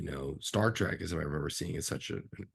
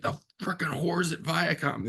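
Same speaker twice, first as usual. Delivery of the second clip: mock angry quote; 9 semitones higher than usual. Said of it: "he's just like".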